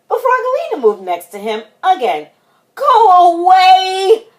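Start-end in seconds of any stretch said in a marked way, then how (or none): 2.8-4.3 high, loud, long wail; drawn-out kid's protest; crying out. A narrator's reading. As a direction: shoo them off with a yell. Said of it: "cried Froggy".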